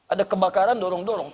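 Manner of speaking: ordinary talk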